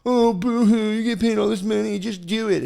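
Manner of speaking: high, loud sneering voice of a listener; mock boo-hoo taunt; mocking whiny critic. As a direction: jeer at yourself like a snide fan. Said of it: "you guys".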